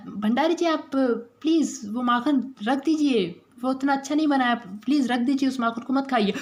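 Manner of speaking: high, hesitant plea; anxious pleading. Acted. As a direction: beg him politely to stop. Woman begs him not to eat. (घबराई हुई विनती)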